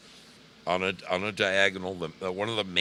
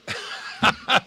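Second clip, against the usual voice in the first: high breathy laugh; 5 dB louder than usual. Real, not acted. Reacting to chat joke.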